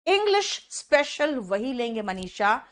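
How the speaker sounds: normal talking voice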